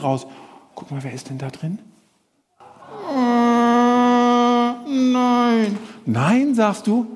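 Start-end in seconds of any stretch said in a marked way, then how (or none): 3.1-5.8 put-on high childish puppet voice refusing; a stubborn little bird puppet, pitched high; puppet Willi. Presenter voicing puppet Willi to children, high and stubborn.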